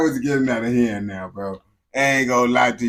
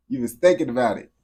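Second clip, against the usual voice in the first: ordinary delivery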